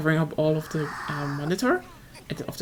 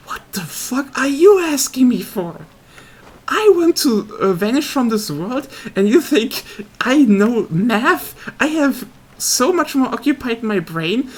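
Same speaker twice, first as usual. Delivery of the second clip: loud, pitched-up exasperated rant; exasperated outburst; 4 semitones higher, 6 dB louder than usual. Retold or imitated. Retelling being pestered about vectors, an exasperated yell. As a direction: snap back at the pestering classmates. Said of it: "And I'm like".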